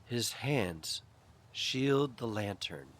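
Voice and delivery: ordinary delivery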